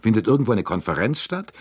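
annoyed. A narrator's reading. Director pressing the conductor for an explanation, annoyed. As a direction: press for a reason with irritation. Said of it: "fragte Bouc verärgert".

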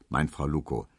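plain reading voice

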